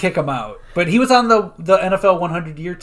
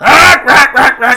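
penguin squawk; 7 semitones higher, 9 dB louder than usual. Retold or imitated.

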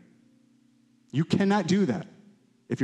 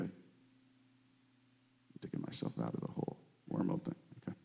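breathy, fast whisper to himself; whispered aside; 7 semitones lower, 16 dB quieter, faster than usual. Real, not acted. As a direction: mutter it under your breath as a joke. Muttered self-aside.

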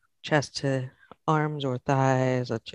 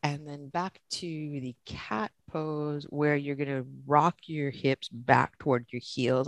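normal talking voice

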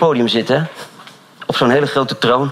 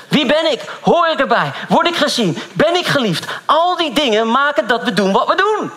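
raised, higher preaching voice; 9 semitones higher than usual. Acted. Pastor preaching to his church, raised higher. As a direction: lift the voice and preach to the room. Preaching to congregation about needs.

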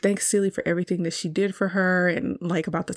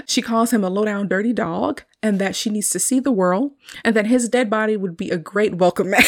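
normal talking voice

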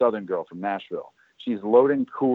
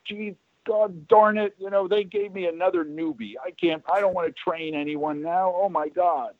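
Sally's mock angry rant; 9 semitones higher than usual. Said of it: "And she got so mad".

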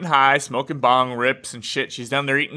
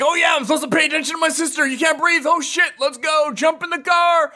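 much higher, frantic near-shout; panicked brother; 12 semitones higher than usual. Retold or imitated. Brother realizing his sister can't breathe, frantic high shout. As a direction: snap out of the high and rush her to the car.

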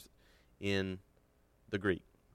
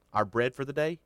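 Our bread for the day raised and louder; stress on bread; 6 semitones higher, 5 dB louder than usual. Shown as a demonstration. Preacher repeating the Greek order, emphatic. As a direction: push the opening words. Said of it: "He puts the emphasis on".

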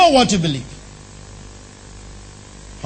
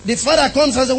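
usual speaking voice

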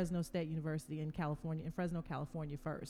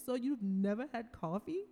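lightly imitated French accent, a bit higher; a friendly hint of a French teammate's accent; 4 semitones higher than usual. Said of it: "in her little French accent".

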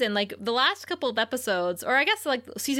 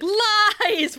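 dramatic exclamation; 10 semitones higher, 6 dB louder than usual. Pretend. Mock dramatic exclamation of lies.

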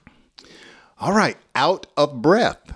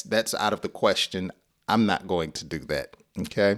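ordinary talk

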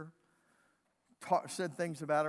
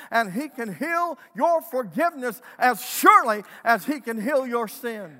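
louder, higher preacher's proclamation; preaching at full force; 7 semitones higher, 10 dB louder than usual. Acted. Pastor preaching to the congregation, full-force proclamation. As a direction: preach it out with conviction to the room.

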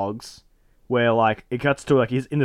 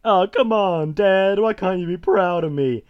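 higher-pitched son's plea; acted pleading; 6 semitones higher, slower than usual. Son begging dad for approval, pleading. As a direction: plead in a raised voice.

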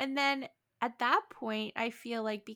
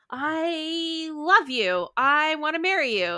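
slow, louder hammed-up declaration; mock proposal; 5 semitones higher, 7 dB louder, slower than usual. Imitating Darcy proposing to Lizzie, hammed-up and slow. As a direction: blurt out love dramatically. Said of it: "He's like".

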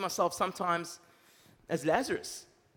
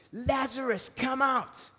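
higher, louder call across a distance; 6 semitones higher, 5 dB louder, much slower than usual. A narrator's reading. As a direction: call the dead man out of the tomb. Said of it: "called out in a loud voice".

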